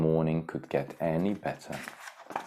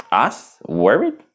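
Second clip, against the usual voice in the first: high, loud mock scoff; hammed-up fake denial; unconvincing scoff; 5 semitones higher, 6 dB louder than usual. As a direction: brush it off, badly. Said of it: "Timon scoffed unconvincingly".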